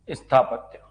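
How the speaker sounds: usual speaking voice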